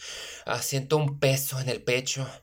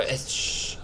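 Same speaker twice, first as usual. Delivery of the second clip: hushed shh; suddenly whispering to stay unheard; 4 dB quieter than usual. Acted. Hearing something in the dark.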